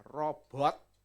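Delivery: normal talking voice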